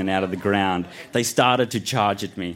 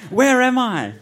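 high-pitched story shout; 4 dB louder than usual. A narrator's reading. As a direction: cry out, lost in a strange place. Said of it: "I shouted".

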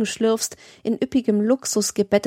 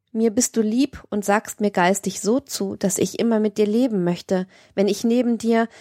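ordinary delivery

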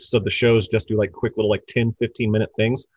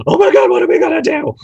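panicked; 7 dB louder, faster than usual. Retold or imitated. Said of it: "I'm just like".

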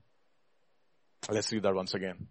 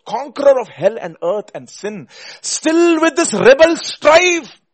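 full-force preaching; 11 semitones higher, 10 dB louder, slower than usual. Real, not acted. Preacher crying Wesley's verse to the congregation, full force. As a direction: build from loud to a high, drawn-out cry.

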